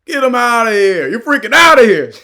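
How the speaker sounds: loud, high mocking dismissal of an outsider; comic loud get-him-out-of-here bit; barbershop clowning. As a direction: run him out like the guys at the barbershop. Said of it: "They'd be like".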